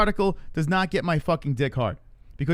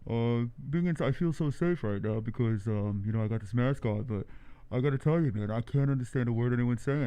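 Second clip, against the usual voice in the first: muffled talk through a face mask; 3 semitones lower, 4 dB quieter than usual. Acted. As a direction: talk to a friend from behind a mask. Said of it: "your voice sounds different with the mask on".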